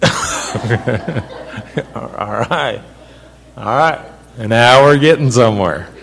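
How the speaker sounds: loud, laughing approval; amused. Real, not acted. Preacher pleased by a listener's answer, laughing and loud. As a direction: say it loudly through a laugh.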